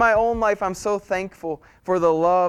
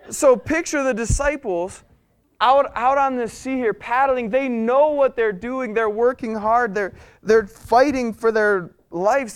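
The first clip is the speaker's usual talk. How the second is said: higher, urgent sermon voice; impassioned preaching; 6 semitones higher, 6 dB louder than usual. Real, not acted. Preacher dramatizing the exhausted rowers' struggle for the congregation, impassioned. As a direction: drive each phrase home with raised pitch. Preacher paints the scene at sea.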